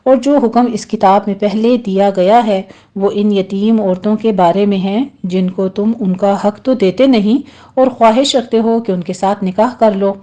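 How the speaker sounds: normal talking voice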